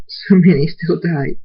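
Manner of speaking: upset protest. Acted. Cadance grieves the many dead.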